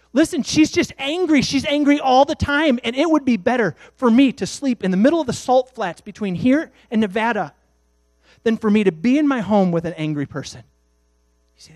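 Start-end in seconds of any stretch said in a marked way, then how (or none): 0.1-10.6 loud, high fed-up rant; a husband ranting loudly about his angry wife; exasperated outburst. Acted. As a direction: vent it loudly, fed up with home. Man acting out his complaint.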